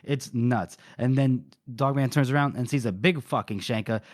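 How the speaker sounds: ordinary delivery, nothing marked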